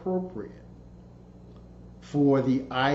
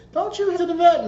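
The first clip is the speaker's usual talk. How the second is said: much higher, louder voice for the visitor; concerned neighbor; 13 semitones higher, 6 dB louder, faster than usual. Retold or imitated. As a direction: ask it up high like the worried friend. Neighbor asks about the dog.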